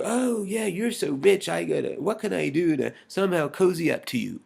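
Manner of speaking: high-pitched mock fawning; fawning flatterer. Mimicking someone sucking up to the rich, fawning. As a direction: gush like a bootlicker cozying up. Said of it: "to go".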